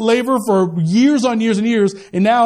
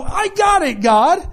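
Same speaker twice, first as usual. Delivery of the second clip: eager; 7 semitones higher, much slower than usual. Retold or imitated. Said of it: "we jump in full bore and go".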